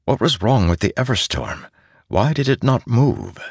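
usual speaking voice

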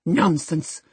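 exasperated exclamation; passionate outburst. A narrator's reading. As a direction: dismiss it in a burst of temper. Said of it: "cried Catherine in a passion".

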